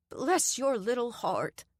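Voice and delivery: slow, lightly voiced Southern grandma; a hint of a kindly old woman; sweet old lady. A narrator's reading. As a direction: coo it like a doting grandmother. Doreen sympathizes.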